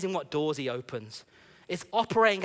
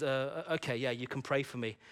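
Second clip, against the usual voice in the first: hesitant, softer and lower agreement; reluctant; 3 semitones lower, 7 dB quieter than usual. Retold or imitated. Woman agreeing to a stranger's prayer, reluctant. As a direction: give in haltingly, quietly. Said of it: "she reluctantly says".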